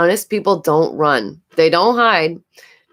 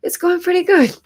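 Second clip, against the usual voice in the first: mock inner voice; 12 semitones higher than usual. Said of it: "and I thought".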